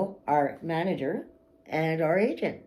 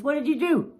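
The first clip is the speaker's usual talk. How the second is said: high, louder accusing question; accusing boss; 10 semitones higher, 3 dB louder than usual. Retold or imitated. Boss blaming the speaker over the broken van, high and accusing. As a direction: snap the blame at him. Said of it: "first thing out of his mouth is".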